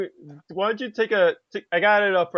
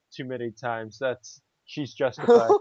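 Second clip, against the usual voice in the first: normal talking voice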